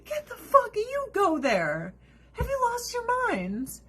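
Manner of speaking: high-pitched, incredulous outrage; high, exasperated retort. Real, not acted. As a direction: pitch shoots up in disbelief. Outraged retort to mocked quote.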